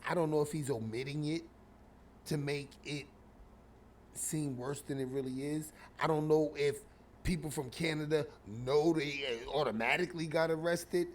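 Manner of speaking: ordinary talk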